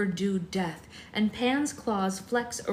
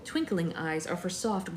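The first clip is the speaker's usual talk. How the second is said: usual speaking voice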